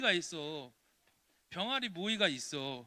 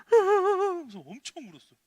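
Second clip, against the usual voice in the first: high, loud mock wail; 13 semitones higher, 14 dB louder than usual. Preacher dramatizing how she cried over the chick, mock wail. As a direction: wail it out like a crying child.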